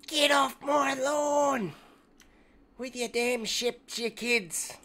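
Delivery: put-on grumpy old man yell; cranky old geezer shouting kids off lawn; shouting at trespasser.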